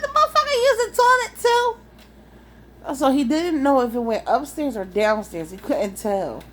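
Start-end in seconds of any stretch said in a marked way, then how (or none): 0.0-2.9 high, slow astonished squeal; mock outrage; shocked disbelief. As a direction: drag it out in amazement, pitched way up. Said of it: "I was like".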